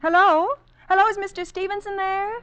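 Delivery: uneasy woman calling down a crossed line; anxious calling out. Acted. Nervous wife calling, strange voices.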